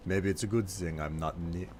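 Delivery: put-on casual, amateur Nordic accent; not-quite Nordic accent. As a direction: hold a loose Nord accent. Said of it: "like, a real Nordic accent".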